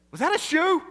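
high, loud startled dad; shocked dad. Retold or imitated. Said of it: "my dad says".

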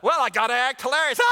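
high, loud mock-hysterical laughing fit; forced hilarity. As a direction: burst into hammed-up laughter, fast and loud. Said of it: "pretty good impersonation of hilarity".

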